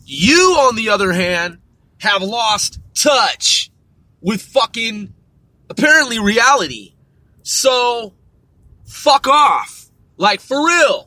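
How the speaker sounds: loud, high angry yelling, slowed on the key words; furious shout. Real, not acted. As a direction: blast it at them, real rage. Ranting at Pelosi's supporters.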